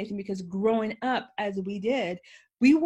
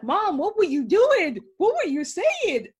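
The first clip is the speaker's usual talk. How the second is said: put-on higher, louder puzzled kids; children asking; 8 semitones higher, 6 dB louder than usual. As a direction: voice the kids as they question mom. Your children were like.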